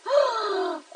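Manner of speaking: high startled gasp; a quick shocked intake of breath; sharp gasp. Real, not acted. Host startled during the livestream, sharp gasp. Surprised on live stream.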